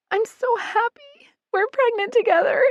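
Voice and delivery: a sister overjoyed and tearful; happy crying. A narrator's reading. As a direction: cry out with happy tears. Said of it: "Jamie cries".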